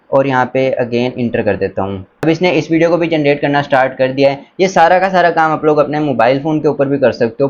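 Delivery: ordinary talk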